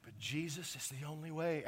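ordinary delivery

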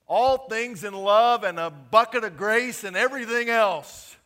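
loud, high, fiery preaching; preaching at full force. Real, not acted. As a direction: shout it out to the congregation.

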